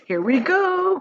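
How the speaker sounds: high, bright showman's cry; excited cry. A narrator's reading. Wonka starting his great machine, crying out excitedly. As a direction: launch the machine with a gleeful shout. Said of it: "cried Mister Wonka".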